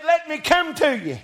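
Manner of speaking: high-pitched preacher's call; hollering. Acted. Peter asks Jesus to let him come, hollering. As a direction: holler it out toward someone far across water. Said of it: "Peter hollered".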